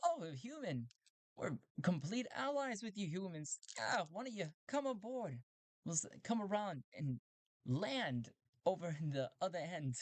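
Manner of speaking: high, eager robot voice; a cheerful robot suddenly all pals; friendly robot. Acted. Robot inviting Talti aboard, high excited robot voice.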